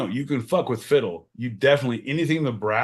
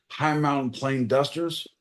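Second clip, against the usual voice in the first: normal talking voice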